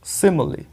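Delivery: सिमिली with short "i" sounds; model pronunciation. Shown as a demonstration. Teacher shows students the word, carefully pronounced सिमिली. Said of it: "स पे छोटी की मात्रा".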